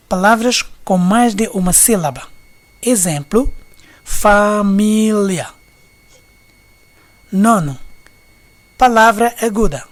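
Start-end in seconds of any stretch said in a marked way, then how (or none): 4.0-5.5 família slow and loud, fa-mí-lia; syllable by syllable. Shown as a demonstration. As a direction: stretch out each syllable of família firmly. Exemplo de divisão em sílabas. (família, sílaba por sílaba, forte e lento)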